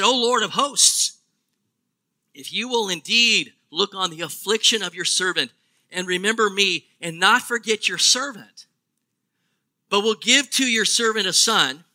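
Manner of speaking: scripture reading. Acted. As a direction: lift the vow out steadily, prayerfully. Reads Hannah's vow.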